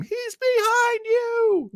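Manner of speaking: high, drawn-out warning cry; imitated fan's scream without full volume; mock audience scream. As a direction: call out like a wrestling crowd. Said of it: "the audience will scream".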